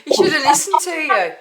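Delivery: high, laughing exclamation. Real, not acted. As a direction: say it through real laughter. Laughing at horse crash story.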